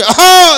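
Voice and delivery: a big, high, loud hawk of the throat; loud throat-clearing. Retold or imitated. Uncle clears his throat at the car window, loud hawking. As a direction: clear the throat hard like a whistle.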